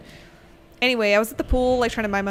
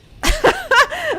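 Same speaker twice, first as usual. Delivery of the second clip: high shrieking laugh bursting out loud; burst of laughter; 9 dB louder than usual. Real, not acted. Streamer hears teammate's beaver joke, loud shrieking laugh.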